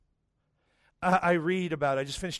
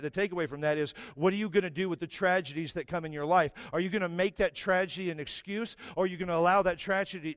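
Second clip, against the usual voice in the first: ordinary talk, nothing marked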